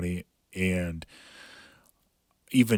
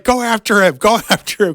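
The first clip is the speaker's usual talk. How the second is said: much higher, loud mock cheering yell; mock crowd yell; 14 semitones higher, 11 dB louder than usual. Crowd urging the fighter to attack, loud mock yell. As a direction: yell like fans urging him on.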